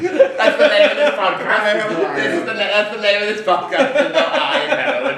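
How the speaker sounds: high, loud laughing delivery. Real, not acted. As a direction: laugh through the joke. Laughing at own joke.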